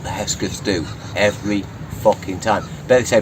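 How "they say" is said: usual speaking voice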